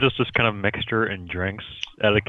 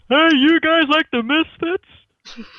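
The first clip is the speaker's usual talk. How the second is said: much higher, loud, slow call out the window; mock shout out window; 6 dB louder, slower than usual. Calling out the window to kids mowing, mock shout. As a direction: yell it down to the lawn kids. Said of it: "just shout".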